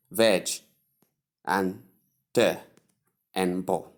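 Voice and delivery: "veg, te, bo" as three separate beats; split into syllables. Shown as a demonstration. Teacher splits vegetable into three, syllable-by-syllable demo. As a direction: break it up: VEJ, pause, TUH, pause, BUL. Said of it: "Combine the three".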